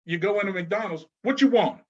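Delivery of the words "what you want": curt, higher mock cashier with a laugh. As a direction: toss it off with project attitude.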